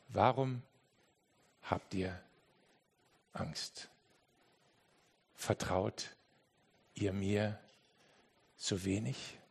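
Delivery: soft, low, gentle question; Jesus speaking softly into the storm; quiet Jesus. Shown as a demonstration. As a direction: say it almost in a hush. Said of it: "dass das Jesus so leise gesagt hat, wie ich es jetzt gerade gesagt habe".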